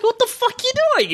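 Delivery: seeing Nate jump a car, shocked; incredulous yell. Retold or imitated. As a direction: shout it in disbelief. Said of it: "I'm like".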